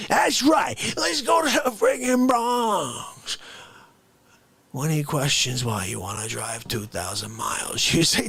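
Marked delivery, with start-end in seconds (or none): 0.1-3.4 hammed-up rallying cry; mock shout. Mock enthusiasm for the trip.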